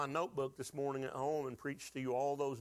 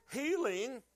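higher, slow proclaimed line; preaching peak; 8 semitones higher, slower than usual. Real, not acted. Preacher answering his church's question, soaring higher and slow. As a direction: lift the word up to the rafters.

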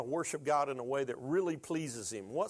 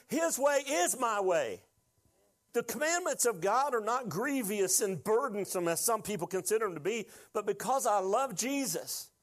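higher, emphatic pulpit delivery; impassioned preaching; 7 semitones higher than usual. Real, not acted. Preacher proclaims Jesus as the Passover lamb, impassioned. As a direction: drive each point home to the congregation.

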